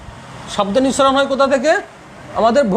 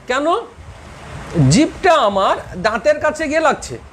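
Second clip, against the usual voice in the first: ordinary talk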